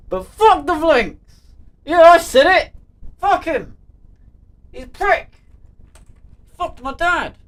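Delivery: high, loud comic outburst; hammed-up furious yelling; mock angry rant. Mock outburst against the Vlinks.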